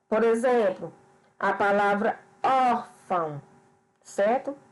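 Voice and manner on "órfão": "órfão" with the open ÓR drawn out; teacher's demonstration of strong ÓR in "órfão"; stress on the accented syllable. Shown as a demonstration. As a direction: lean on the first syllable ÓR of "órfão". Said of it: "o acento que intensifica o som mais forte".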